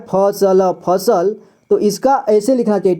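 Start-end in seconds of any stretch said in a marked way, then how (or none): 0.1-0.7 "फसल" as pha-sa-la, every letter voiced; full pronunciation. Shown as a demonstration. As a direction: sound out all of "फसल", vowel on each letter. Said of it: "फसल का पूरी तरह से उच्चारण".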